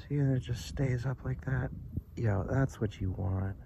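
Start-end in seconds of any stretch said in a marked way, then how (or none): none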